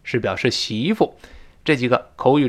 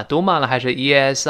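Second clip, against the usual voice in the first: ordinary delivery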